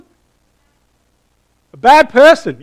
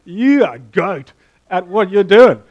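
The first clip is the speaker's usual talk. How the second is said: put-on teen slang voice; 2 semitones higher, 9 dB louder than usual. Shown as a demonstration. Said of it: "if we say".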